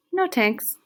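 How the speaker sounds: high, loud, brisk refusal; waving her off. A narrator's reading. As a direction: call back a quick brush-off. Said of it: "Waving her off, he replies".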